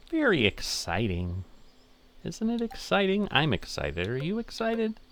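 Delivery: sarcastic, pitch jumping high on questions; mock excitement. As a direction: fake enthusiasm, sing-song. Mock hype over golf shot.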